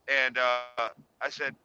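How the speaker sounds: usual speaking voice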